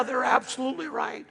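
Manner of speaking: ordinary talk, nothing marked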